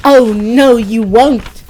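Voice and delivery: loud defiant shout; goat's shout. A narrator's reading. Biggest goat shouting down the troll, loud and defiant. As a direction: shout back at the troll fearlessly. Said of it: "the biggest goat shouted".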